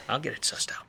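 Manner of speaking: sigh, then whisper it under the breath; a weary aside to himself, barely voiced; tired whispered promise. Detective mutters to himself.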